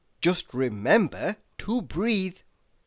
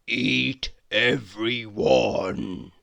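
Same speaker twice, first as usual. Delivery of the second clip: a lightly voiced monster, lower and slowed; 5 semitones lower, slower than usual. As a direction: growl it out slowly like a beast swallowing everyone. Mock monster ending to advice.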